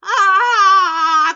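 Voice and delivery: loud, high delighted cry; a real shout of delight at the card; excited cry. Real, not acted. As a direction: burst out with a joyful cry. Excited cry over a tarot card.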